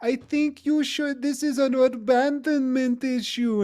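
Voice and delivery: put-on German accent. Host imitating Doctor Ruth's German accent giving advice. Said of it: "That's my best German accent".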